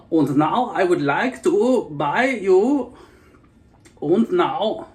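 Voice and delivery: slow, high, stiff mock German; mock German with careful formal pacing; German accent. German accent, to viewers. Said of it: "it's very different from".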